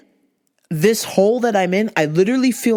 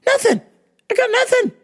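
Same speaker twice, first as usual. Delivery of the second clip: high-pitched voice imitating mom; mock helpless reply; faster than usual. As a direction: squeak out an empty-handed answer. Acting mom's empty answer on phone.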